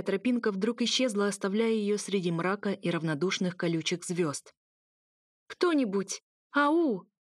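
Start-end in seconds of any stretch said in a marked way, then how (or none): 5.5-7.1 high, cracking call into the dark; calling out, voice breaking. A narrator's reading. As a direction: shout for help, voice giving out. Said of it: "Голос срывался и сипел".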